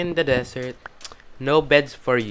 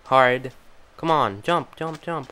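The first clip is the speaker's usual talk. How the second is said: ordinary talk, nothing marked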